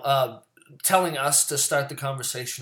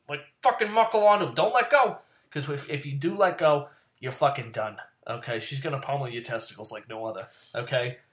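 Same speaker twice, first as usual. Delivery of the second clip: usual speaking voice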